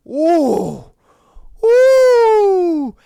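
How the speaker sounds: high, loud drawn-out ooh. Real, not acted. Host reacting to husband caught lying, high loud ooh. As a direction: wince out loud at it.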